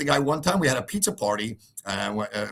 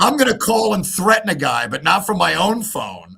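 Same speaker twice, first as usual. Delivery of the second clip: mocking the father; 7 semitones higher, 7 dB louder than usual. Mimicking the father's thoughts.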